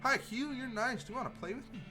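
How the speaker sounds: high, sweet little doll voice; talking doll. Acted. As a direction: play a creepy child doll. Voicing the doll.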